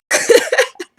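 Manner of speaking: loud, high burst of laughter; high-pitched real giggle fit. Real, not acted. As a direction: crack up helplessly at yourself. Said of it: "I have to laugh".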